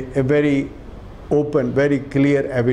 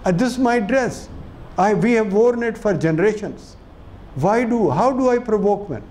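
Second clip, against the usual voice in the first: put-on higher-pitched protesting girl's voice; imitating an indignant girl; 6 semitones higher than usual. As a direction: plead your innocence in a raised, hurt voice. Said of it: "And she says".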